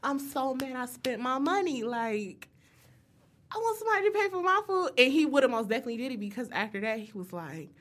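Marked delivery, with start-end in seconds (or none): 0.0-4.9 high-pitched retold grumbling; mock-indignant complaint; worked-up, whiny venting; pissed off. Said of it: "I was literally pissed the whole night".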